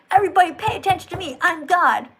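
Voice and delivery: fast, loud, self-important mimicry; mock an attention-hungry deity, rushing and loud; mock narcissistic God. Atheist imitating God demanding attention, mock self-important. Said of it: "he's a narcissist".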